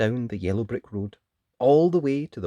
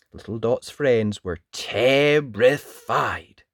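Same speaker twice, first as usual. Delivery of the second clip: usual speaking voice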